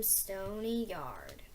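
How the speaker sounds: ordinary delivery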